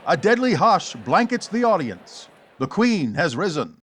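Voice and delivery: ordinary talk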